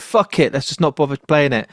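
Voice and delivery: ordinary talk, nothing marked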